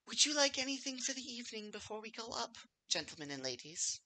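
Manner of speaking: high, soft, sweet hostess voice; kindly older lady. Acted. Barmaid asking guests if they want anything, kindly older lady. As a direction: offer service in a gentle motherly voice. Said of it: "very kindly looking older lady comes out".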